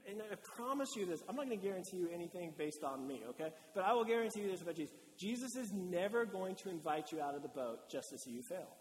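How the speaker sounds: normal talking voice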